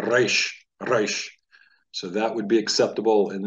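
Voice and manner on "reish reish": "Reish, Reish" with a trilled R; rolled R. Shown as a demonstration. Teacher repeating the trilled R for students. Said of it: "they would roll the R".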